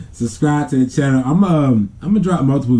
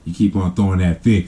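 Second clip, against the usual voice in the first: low, menacing uncle threat, pitched lower; Uncle Wally threatening; 6 semitones lower than usual. Retold or imitated. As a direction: growl a threat at the kid like an angry uncle. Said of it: "he gave him that look like".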